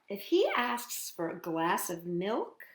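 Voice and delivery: normal talking voice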